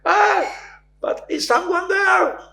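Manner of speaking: very high, loud desperate call; desperate cry. Retold or imitated. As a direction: plead upward into the void. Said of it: "and the poor man". (very high, loud desperate call)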